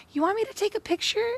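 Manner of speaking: high, quick, overeager offer; squeaky eager stranger. Retold or imitated. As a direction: chirp it like a too-helpful passerby.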